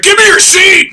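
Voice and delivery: high, loud mocking yell; hammed-up demanding yell; mock shout. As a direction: shout it like a selfish jerk. Retelling a rude man in crash.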